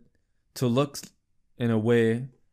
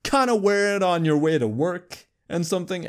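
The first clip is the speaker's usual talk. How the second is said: mock casual; 7 semitones higher than usual. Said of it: "trying to make it look like".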